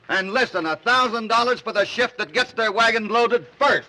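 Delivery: big rallying shout to the crew. Acted. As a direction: call it out loud over the crowd. Boss announces a prize.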